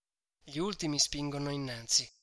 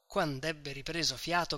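ordinary delivery

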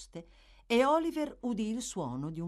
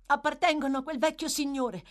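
put-on high, louder pleading boy; anxious plea; 9 semitones higher, 5 dB louder than usual. A narrator's reading. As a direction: wring your hands and protest. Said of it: "disse Oliver, torcendosi le mani".

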